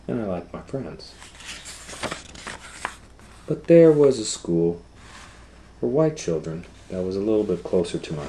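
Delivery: loud, lively storybook voice; reading to children. Acted. Teacher reads Ruby's first-person story to the class, loud and lively. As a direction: tell it like Ruby telling her own story.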